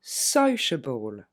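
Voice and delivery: Sociable said very slowly, able unstressed; slow model word. Shown as a demonstration. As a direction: drag sociable out, weak ending. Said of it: "la correction". (Sociable très lentement, fin non accentuée)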